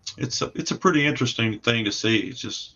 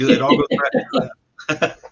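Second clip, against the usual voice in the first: laughing; 14 semitones higher, 3 dB louder than usual. Real, not acted. Jokes about his Italian accent.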